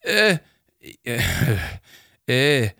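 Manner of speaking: lost for words, faltering; stammering. A narrator's reading. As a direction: stammer with gaps between each sound. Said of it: "stammede Hugo Bæk".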